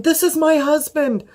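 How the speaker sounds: high-pitched, near-shrieking correction; panicked retort. Retold or imitated. Correcting the paramedic about her husband, panicked and high. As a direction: blurt it out in a flat-out panic. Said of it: "I'm in a flat out panic".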